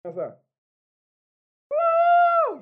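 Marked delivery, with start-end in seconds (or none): 1.7-2.6 high shouted woo of a crowd; excited woo. Retold or imitated. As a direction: whoop like a thrilled congregation. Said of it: "you know the response".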